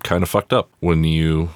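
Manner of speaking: ordinary talk, nothing marked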